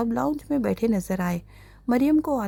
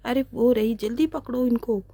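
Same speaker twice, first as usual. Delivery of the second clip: ordinary delivery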